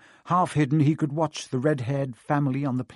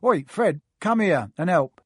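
calling out; 4 semitones higher, 5 dB louder than usual. A narrator's reading. Twin calls his brother.

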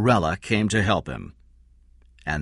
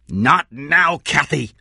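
sharp raised voice, higher; shouted; 5 semitones higher, 4 dB louder, slower than usual. Acted. Eddie brushes off Kathy, raised voice. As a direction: Eddie snaps at Kathy over the microphone, shouting. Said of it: "he shouted".

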